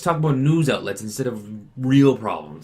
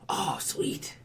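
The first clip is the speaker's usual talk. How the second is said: whisper; 8 semitones higher, 8 dB quieter than usual. Pretend. Mock delight after weed joke.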